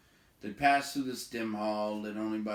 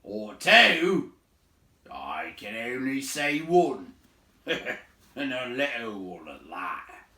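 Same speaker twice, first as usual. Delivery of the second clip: loud, slow scoffing boom with a laugh; mocking giant; 3 semitones higher, 10 dB louder, slower than usual. A narrator's reading. Big man scoffing at the hobbit, loud slow laugh. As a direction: scoff at the little one. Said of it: "said Beron".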